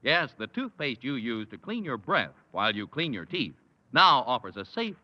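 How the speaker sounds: ordinary delivery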